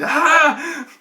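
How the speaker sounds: high imitated scream; short high scream, acted out; mock scream. Game master imitates screaming in the listener's ears, mock scream. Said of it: "starts screaming".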